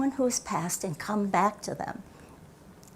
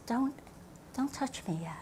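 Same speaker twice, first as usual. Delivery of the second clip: gentle; 4 semitones higher, 13 dB quieter than usual. Acted. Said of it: "And he says".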